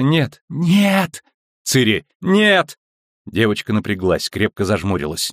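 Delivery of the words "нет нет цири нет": high, rising cries of no; pleading, high-pitched protest; alarmed cry. A narrator's reading. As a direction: cry out in alarm to stop her. Alarmed refusal in the scene.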